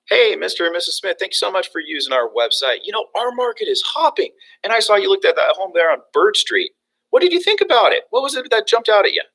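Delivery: high, bubbly, confident phone greeting; eager agent pitching with high, lively lilt; upbeat sales call. Shown as a demonstration. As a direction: pour on the friendly enthusiasm. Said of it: "But notice how I called".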